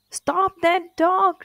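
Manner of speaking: high, slow, loud shouted order; king shouting. A narrator's reading. King shouting at the crowd to stop the dog, high and loud. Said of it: "shouted the king".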